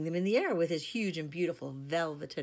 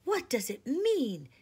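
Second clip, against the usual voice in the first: bewildered; 9 semitones higher, 8 dB louder, slower than usual. A narrator's reading. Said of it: "said Susan".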